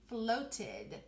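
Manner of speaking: "floated" with a crisp T in the middle; stretched out, every sound. Shown as a demonstration. Teacher repeating all the sounds for spellers, careful T in "floated". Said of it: "if I really stretch it out and say all of those sounds".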